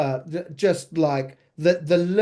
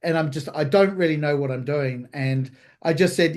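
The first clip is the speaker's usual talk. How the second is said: usual speaking voice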